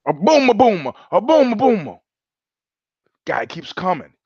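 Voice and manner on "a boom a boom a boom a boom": mock Italian accent, higher and louder; Italian soldier. Soldier fakes grenade in battle, mock Italian accent. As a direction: shout the fake grenade. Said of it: "World War Two joke".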